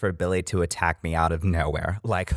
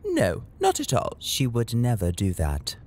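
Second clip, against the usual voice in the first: fake British accent; 2 semitones higher than usual. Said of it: "Did y'all just talk in fake British accents?".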